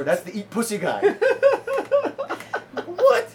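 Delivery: high laughing "What?"; laughing disbelief. Real, not acted. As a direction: laugh it out in shock. Laughing retelling the reveal.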